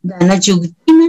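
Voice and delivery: normal talking voice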